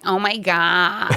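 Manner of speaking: put-on slow, drawn-out American accent; put-on North American drawl. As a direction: do an American woman gushing, vowels stretched. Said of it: "try to do a North American accent".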